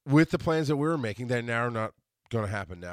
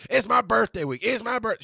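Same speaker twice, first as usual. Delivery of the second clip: high, louder mock brag; mocking imitation; 10 semitones higher, 5 dB louder than usual. Host mimics a birthday braggart repeating it, mocking. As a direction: parrot an over-eager announcer.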